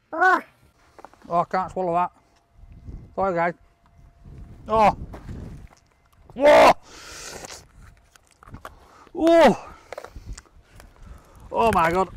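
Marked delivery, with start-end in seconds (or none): 0.1-0.4 high sharp gasp as the chili burns; pained gasp from chili heat. Real, not acted. Reacting to hot chili. 6.3-9.7 loud sharp cry at the heat; burning gasp. Real, not acted. Burning from chili.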